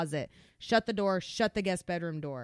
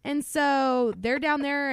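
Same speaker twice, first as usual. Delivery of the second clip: ordinary delivery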